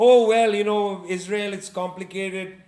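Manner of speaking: mock the wishy-washy left's excuse; mock dithering. Speaker mocks the European left's hedging about Israel, sarcastic. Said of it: "paralyzed about".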